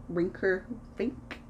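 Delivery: ordinary delivery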